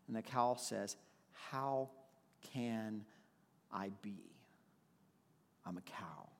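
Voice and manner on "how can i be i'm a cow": fading to a whisper as the cow answers; hushed cow voice. Acted. To the baby bird, the cow answers in a fading whisper. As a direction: let the cow's answer sink into a whisper.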